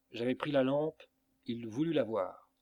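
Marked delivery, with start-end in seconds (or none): none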